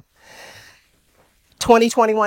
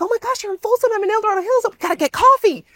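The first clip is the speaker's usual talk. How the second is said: much higher, gushing invite; excited; faster than usual. Retold or imitated. Speaker to the woman, inviting her to coffee, gushing high. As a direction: bubble over with excitement. Excited meeting after the talk.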